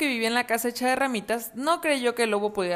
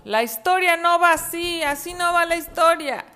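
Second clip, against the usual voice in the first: whiny wolf protest; 8 semitones higher than usual. Acted.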